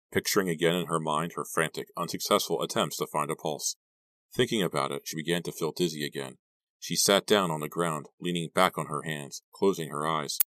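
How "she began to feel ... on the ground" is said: usual speaking voice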